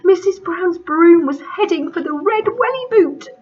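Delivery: high, loud frightened cry; panicked cry. Acted. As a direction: shout it in panic. Spiders panicking again.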